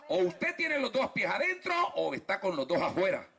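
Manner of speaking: ordinary delivery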